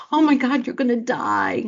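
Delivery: high, loud alarmed outburst; panicked outburst. Retold or imitated. Coach imitating a friend panicking over cancer news. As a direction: blurt it in rising panic. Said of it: "Do you automatically go".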